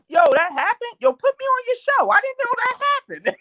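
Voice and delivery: high, hyped-up imitation of the comedian; excited high imitation. Guy who heard the story begs to join the show, excited high voice. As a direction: pitch way up, eager and amazed.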